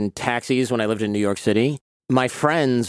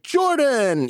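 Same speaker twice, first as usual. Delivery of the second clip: high, bright call of a name; cab driver calling out; 12 semitones higher than usual. Retold or imitated. Cab driver hailing his regular passenger by name.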